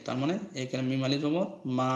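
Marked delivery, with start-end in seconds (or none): 1.8-1.9 মা with the vowel held long; stretched long vowel. Shown as a demonstration. Teacher showing madd to learners, মা held long. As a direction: draw out the আ of মা for one alif. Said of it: "জবরের পর খালি আলিফ".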